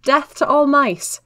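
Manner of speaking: loud, slow, high cry again; shouted. A narrator's reading. Maud repeating the cry at Wrath, loud and slow. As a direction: yell the phrase as a diversion.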